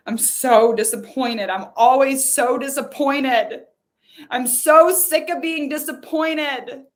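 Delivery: loud, building cry of disappointment; fed-up disappointment. Shown as a demonstration. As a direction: let the disappointment burst out, rising in pitch. Leading emotional release of disappointment.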